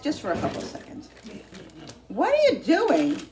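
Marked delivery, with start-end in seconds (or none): 2.1-3.2 high, loud and slow; loud call. Real, not acted. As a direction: call out to the dog. Calling to the dog.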